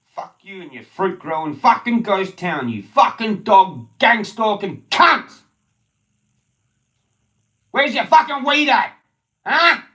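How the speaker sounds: furious screaming. Real, not acted. Demanding weed from the locals, furious scream. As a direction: scream the demands at full rage.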